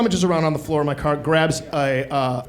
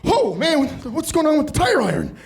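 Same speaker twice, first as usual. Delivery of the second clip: high-pitched, nervous voice of a worried friend; worried Jeff; 10 semitones higher than usual. Retold or imitated. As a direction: pitch it way up, anxious and hurried. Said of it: "and he goes".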